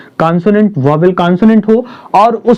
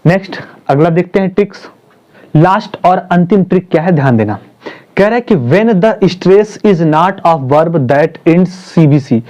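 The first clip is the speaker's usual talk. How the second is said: ordinary talk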